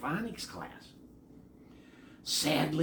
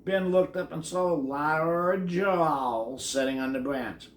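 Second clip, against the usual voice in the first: plain reading voice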